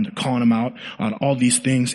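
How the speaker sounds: normal talking voice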